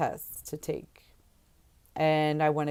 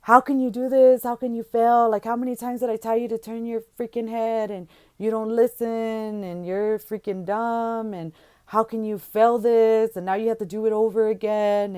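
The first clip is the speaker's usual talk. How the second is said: loud, higher furious rant; angry yelling; 6 semitones higher, 5 dB louder than usual. Retold or imitated. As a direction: yell at her for failing. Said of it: "he is pissed off".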